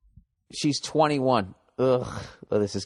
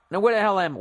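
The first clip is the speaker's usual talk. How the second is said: ordinary delivery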